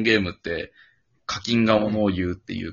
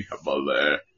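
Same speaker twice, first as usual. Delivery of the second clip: low, dramatic catchphrase; 8 semitones lower, 5 dB quieter, slower than usual. Retold or imitated. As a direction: drop the voice for the slogan. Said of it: "ハマれって言っていただきたい".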